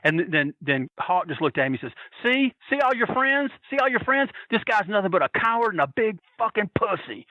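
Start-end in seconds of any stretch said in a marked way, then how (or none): 2.2-7.3 high-pitched retold tirade; mock scream of a wrestler humiliating someone; imitated screaming. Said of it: "And he's screaming".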